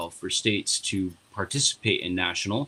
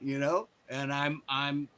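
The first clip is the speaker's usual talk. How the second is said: ordinary talk, nothing marked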